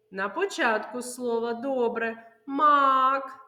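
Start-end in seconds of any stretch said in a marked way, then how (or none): none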